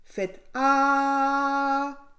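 long, loud sustained "ah"; doctor's instruction. A narrator's reading. Doctor examines the sick wolf, drawn-out demonstrating voice. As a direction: hold the "ah" high and steady.

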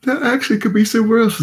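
words stretched through a big yawn. Real, not acted. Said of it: "As I yawn on y'all".

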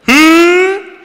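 loud, high-pitched rising "hoo" call; high mock alarm noise; mocking alert hoot. Shown as a demonstration. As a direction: sound a siren-like hoot to flag the crack. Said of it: "that's the noise we made if somebody cracked their voice".

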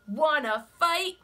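high, loud, grumpy bug; grouchy ladybug voice. Acted. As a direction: bark the challenge up high. Ladybug challenges lobster.

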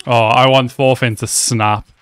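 normal talking voice

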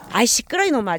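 loud mock-annoyed hush; loud fond telling-off; scolding the dog. Real, not acted. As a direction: tell the dog off loudly.

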